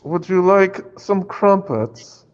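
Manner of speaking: high, slow hammed-up British accent; bad British accent. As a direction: drag it out like a mock posh Brit. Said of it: "my British accent's horrible".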